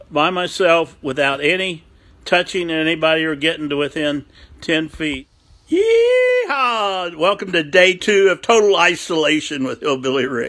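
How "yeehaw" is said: high whooping cowboy yeehaw; yeehaw yell. Acted. Cowboy host whooping to his viewers. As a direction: let the yeehaw rip up high.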